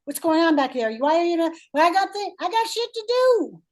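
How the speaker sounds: irritated thinking brain. Retold or imitated. Coach voicing the client's impatient thinking brain, exasperated. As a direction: rant like an impatient inner voice climbing to a squeak. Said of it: "that's going on with that thinking brain".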